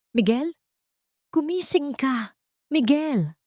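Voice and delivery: high, tearful pleading cry; crying plea. Acted. Isabel shakes unconscious Miguel, crying plea. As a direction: beg him through tears to wake up. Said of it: "Umiiyak na".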